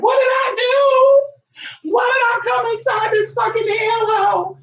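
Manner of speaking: high, slow wailing cry, hammed up; screeching baby cry, played for laughs; mock crying shout. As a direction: wail it like a furious newborn. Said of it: "They be crying like".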